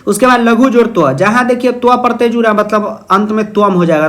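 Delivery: ordinary delivery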